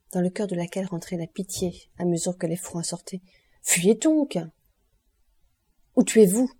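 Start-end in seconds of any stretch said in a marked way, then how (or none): 3.6-4.4 higher-pitched urging cry; cri pressant. A narrator's reading. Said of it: "cria mademoiselle d'Armilly". 6.0-6.5 loud, commanding verdict; ordre impérieux. A narrator's reading. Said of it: "dit Eugénie du ton, et avec la pose d'une de ces vestales".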